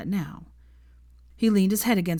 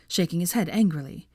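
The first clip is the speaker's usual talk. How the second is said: usual speaking voice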